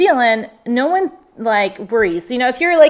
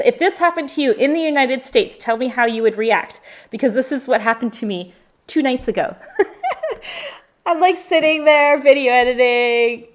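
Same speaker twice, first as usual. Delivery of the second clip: ordinary talk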